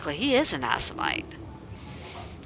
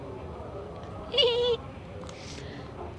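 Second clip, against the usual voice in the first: breathy high chuckle; soft laugh; 5 dB quieter than usual. Real, not acted. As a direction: chuckle at the comment.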